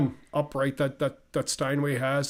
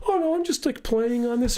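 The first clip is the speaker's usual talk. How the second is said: put-on modest teen self; 6 semitones higher than usual.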